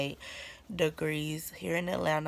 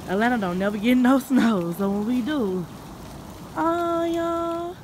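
excited; 5 semitones higher, 6 dB louder than usual. Real, not acted. Excited about the snow.

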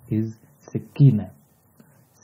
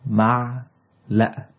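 معلقة slowly, in two parts with a break; split into syllables; 3 semitones higher than usual. Shown as a demonstration. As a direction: break معلقة apart for learners. Teacher splits word for learners.

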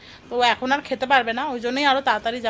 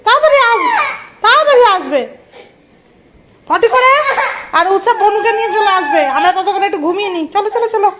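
much higher and louder, laughing urging; excited hurrying; 7 semitones higher, 7 dB louder than usual. Real, not acted. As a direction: chivvy the little one along. Hurrying the toddler to potty.

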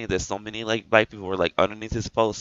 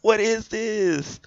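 very high, drawn-out squeal of disbelief; high-pitched, stretched-out incredulous whine; outraged squeal; 13 semitones higher, 3 dB louder, slower than usual. Real, not acted.

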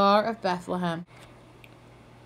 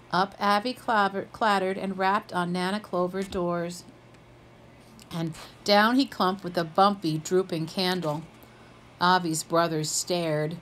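ordinary delivery

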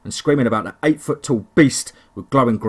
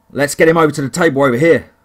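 urgent call; 3 semitones higher, 7 dB louder than usual. Acted. Men carrying wounded man.

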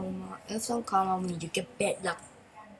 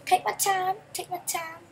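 quick and louder, higher; rushing the words out; eager take my time; 9 semitones higher, 5 dB louder than usual. Shown as a demonstration. Gamer hurrying his car along, eager and loud. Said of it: "Yep, slowly".

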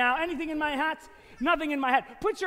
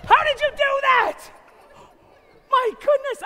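high, loud astonished shout; hammed-up amazement; 11 semitones higher, 6 dB louder than usual. Magician praises the child helper, amazed shout. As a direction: overplay the wonder for the child.

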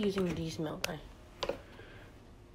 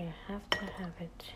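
whispering; 5 dB quieter than usual. Real, not acted. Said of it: "Sorry I'm speaking low".

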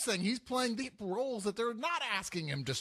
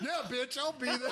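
higher mock movie-star voice; Channing Tatum; 5 semitones higher than usual. Punchline after comparing how people talk, Channing Tatum voice. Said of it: "Channing Tatum talks like this".

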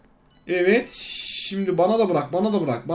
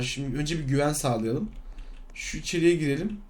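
ordinary talk

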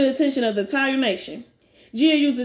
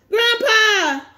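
high, loud cry of Grandpa!; 9 semitones higher, 4 dB louder than usual. Acted. Grandson crying out to his dying grandfather, high and loud. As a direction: call out desperately, high and loud.

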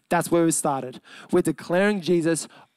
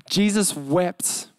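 slow, drawn-out Jesus wept; slow repeat; much slower than usual. Acted. Preacher repeating the verse to his listeners, slow and deliberate. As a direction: stretch each word to let it sink in.

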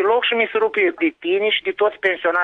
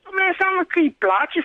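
angry shout; 12 semitones higher than usual. Real, not acted. Neighbour defending his home, shouting angrily. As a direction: fire it back, outraged.

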